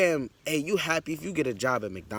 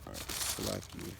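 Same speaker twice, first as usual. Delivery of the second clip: muttered aside; 13 dB quieter than usual. Real, not acted. Muttering while finding the verse.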